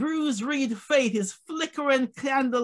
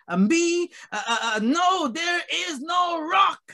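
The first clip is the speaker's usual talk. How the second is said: raised, emphatic pulpit delivery; forceful preaching; 3 semitones higher than usual. Acted. Preacher proclaiming God's words through Isaiah, forceful and rising. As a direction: build the verse to a ringing declaration.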